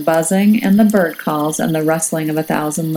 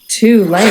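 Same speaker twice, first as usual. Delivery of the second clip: "Too late" echoed louder, rising on "Too"; dramatic echo; 3 semitones higher, 5 dB louder than usual. A narrator's reading. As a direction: punch "Too" to build suspense.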